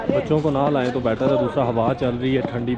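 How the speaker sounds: usual speaking voice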